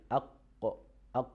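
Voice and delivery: "aq" with a stopped q and a small echo; qaf demo. Shown as a demonstration. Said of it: "So we see".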